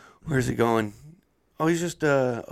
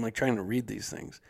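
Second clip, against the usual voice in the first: ordinary talk, nothing marked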